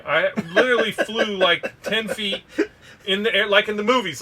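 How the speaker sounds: real laughter breaking into the words; laughing through it. Real, not acted. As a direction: laugh as you tell the absurd part. Laughing while telling the punch.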